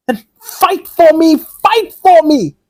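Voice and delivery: high, loud and slow, begging; desperate plea. Acted. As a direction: cry it out, drawn out and high. Amy possessed, pleading desperately.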